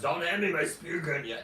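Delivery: lightly voiced old diver; imitating Tom. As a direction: quote buddy in a slightly put-on voice. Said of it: "He doesn't talk like that".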